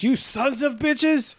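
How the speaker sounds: furious fist-shaking cry; angry yell. Retold or imitated. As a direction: shout it, drawn out and high. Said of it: "He's like".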